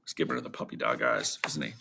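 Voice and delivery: plain reading voice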